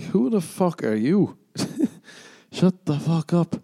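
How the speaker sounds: incredulous comeback. Retold or imitated. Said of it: "I'm like".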